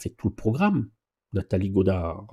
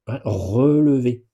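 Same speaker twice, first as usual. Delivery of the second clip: relever said slowly and louder, each syllable drawn out; stress on relever; 6 dB louder, much slower than usual. Shown as a demonstration. Instructor correcting a student's wording, stressing relever slowly. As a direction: lean on the word relever, slow and firm. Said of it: "on dit pas lâcher, on dit accompagner".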